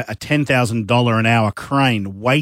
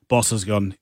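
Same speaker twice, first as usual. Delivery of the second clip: ordinary delivery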